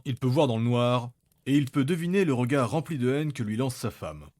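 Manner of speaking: usual speaking voice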